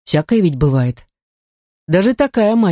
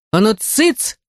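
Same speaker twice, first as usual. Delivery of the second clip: scolding cry; 5 semitones higher, slower than usual. A narrator's reading. Artefactor hushing the howling house spirit, mild scolding cry. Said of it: "прикрикнула я".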